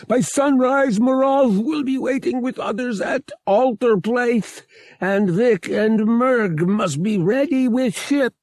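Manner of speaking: high, slow, loud pleading alien voice; the alien begs loudly in a high voice; frantic alien. A narrator's reading. Murg frantically begs Han for a plan, high, slow and loud.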